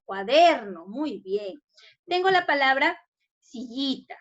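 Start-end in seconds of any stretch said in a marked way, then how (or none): 0.1-0.9 "cuaderno" with DER held firm; stress on DER. Shown as a demonstration. Teacher correcting for pupils, stress on DER in "cuaderno". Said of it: "debe ir en la der".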